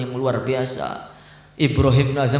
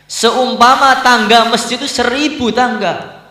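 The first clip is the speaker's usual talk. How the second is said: high, loud, rapturous outburst; ecstatic cry; 12 semitones higher, 9 dB louder than usual. Acted. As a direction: cry it out as if overwhelmed with joy. Preacher voices the saint's ecstatic words.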